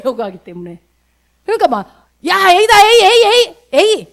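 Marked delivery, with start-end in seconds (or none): 2.3-4.1 loud, high, exasperated scolding; hammed-up booming rebuke; big mock yell. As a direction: shout it like an impatient boss correcting someone. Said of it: "이게 크게".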